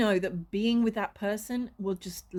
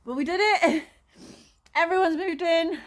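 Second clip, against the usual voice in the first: louder and higher, a win; moving-day relief, triumphant; 6 semitones higher, 5 dB louder than usual. Real, not acted. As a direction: celebrate getting it done. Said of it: "moving day finally done".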